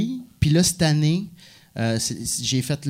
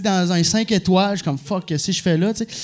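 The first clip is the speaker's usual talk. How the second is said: ordinary talk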